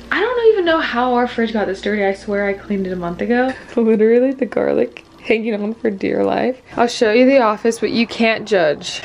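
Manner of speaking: ordinary delivery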